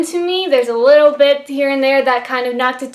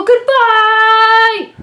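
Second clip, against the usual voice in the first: a high, loud, sing-song "goodbye!"; louder and higher, calling out a teasing farewell to the non-spoiler viewers; playful shouted goodbye; 8 semitones higher, 5 dB louder than usual. Pretend. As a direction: wave them off with a big cheery call.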